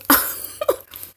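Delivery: breathy high-pitched laugh. Real, not acted. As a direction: burst into a high giggle. Laughs at own bit.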